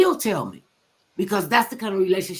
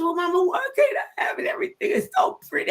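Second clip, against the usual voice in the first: high, whining pleading daughter; lightly voiced young girl begging her mother; childlike plea; 13 semitones higher, faster than usual. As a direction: beg mama like a young girl.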